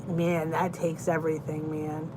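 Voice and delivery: ordinary talk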